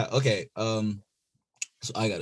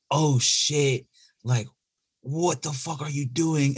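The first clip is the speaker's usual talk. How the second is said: higher, slow startled brother; a mock caught-you gasp; shocked big brother; 5 semitones higher, slower than usual. Brother catches him, voiced.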